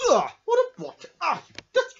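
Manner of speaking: high, loud revolted cry; disgusted shriek. Acted. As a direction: recoil in disgust. Disgusted by dirty hanky.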